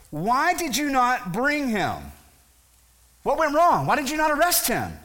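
high-pitched, indignant questioning; demanding interrogation. Acted. Pharisees confront the returning temple guards, demanding. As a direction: confront them, pitch pushed well up.

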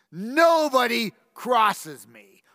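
slow, shouted threat; angry shout. Acted. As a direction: bellow it as a warning. Tyrant's threat.

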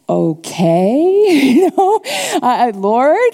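puzzled, nervously amused appeal to God; unsure, baffled. Retold or imitated. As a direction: reenact not knowing what to make of it. Baffled reaction to the woman crying.

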